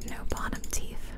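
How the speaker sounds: ordinary talk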